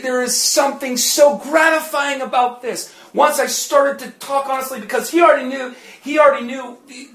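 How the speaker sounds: loud, rising preacher's climax, often shouted; preaching at full force. Acted. Preacher testifying to congregation about deliverance, loud and fervent. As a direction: drive the testimony home at full volume.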